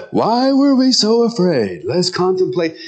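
high, mock-contemplative musing; pious disciples. Imagined disciples pondering Jesus' question, mock-pious. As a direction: wonder aloud in a high, put-on pious voice. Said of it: "they would say".

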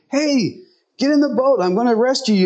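a hint of a rescuer calling out; boat rescuer call. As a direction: urge him to get in, lightly voiced. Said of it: "And he said".

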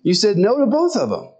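a hint of an exasperated God. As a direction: set him straight, lightly voiced. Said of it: "And he said".

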